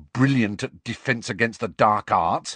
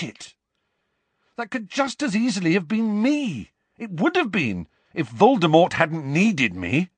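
angry outburst; 10 semitones higher than usual. Acted. Harry's angry outburst at friends.

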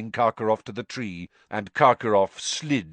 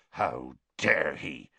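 lower, slow growl; 7 semitones lower, 4 dB quieter, slower than usual. Acted. Hagrid to Harry, growling with outrage. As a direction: rumble it in outrage. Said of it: "Hagrid growled".

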